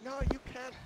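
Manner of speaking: high, loud imitated Chinese accent. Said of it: "that Chinese accent".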